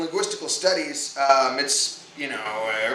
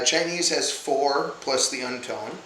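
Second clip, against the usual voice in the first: normal talking voice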